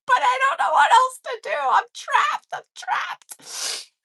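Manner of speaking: high, rushed comic wail; hammed-up trapped panic; retold desperation. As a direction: wail it, then run out of voice. Retold desperate confession, cracking up.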